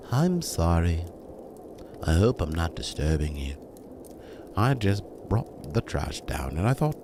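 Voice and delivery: low, hesitant apology with pauses; feeble, timid voice. A narrator's reading. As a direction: apologise timidly, as if afraid to intrude. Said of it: "In her feeblest and most timid voice".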